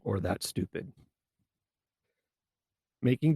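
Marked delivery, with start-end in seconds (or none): 0.2-0.9 muttered scornful verdict; a tossed-off contemptuous put-down; harsh dismissal. Shown as a demonstration. Demo of shutting someone down, scornful. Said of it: "Much better than".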